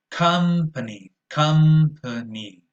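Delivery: "company" drawn out slowly, middle vowel a relaxed schwa; slow schwa demo. Shown as a demonstration. Teacher modelling company for the class, slow and clear. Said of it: "but with a schwa".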